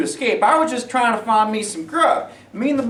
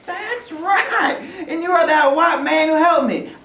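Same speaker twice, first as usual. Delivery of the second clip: laughing; 6 semitones higher, slower than usual. Acted. Recognising his old helper.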